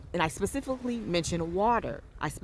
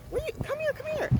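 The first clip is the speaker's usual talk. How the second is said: very high cooing call; coaxing; faster than usual. Real, not acted. Calling a jellyfish closer, cooing very high. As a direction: coo at it to come closer.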